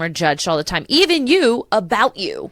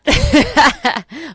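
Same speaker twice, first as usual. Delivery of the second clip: a loud, bright burst of real laughter, higher; laughing through tears; 8 semitones higher, 7 dB louder than usual. Real, not acted. Host laughing at herself for crying on the episode. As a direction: laugh it off after getting teary. Laughs after admitting she cried.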